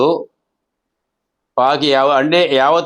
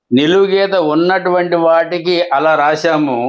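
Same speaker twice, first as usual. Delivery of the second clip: ordinary talk, nothing marked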